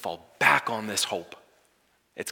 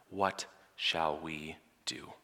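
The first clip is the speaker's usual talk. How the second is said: frightened whisper; 6 semitones lower, 10 dB quieter, slower than usual. Acted. Frightened servant asks Elisha.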